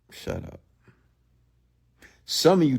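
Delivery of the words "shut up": very soft, whispered brush-off; muttered dismissal. Real, not acted. Streamer dismissing followers' empty boasts, muttered. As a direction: drop to a mutter under your breath.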